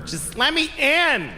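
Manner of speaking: high, slow, drawn-out mock plea. Mexican at the border asking entry, high mock plea. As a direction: plead slowly in a high caricature voice. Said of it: "do a totally offensive stereotype of a Mexican".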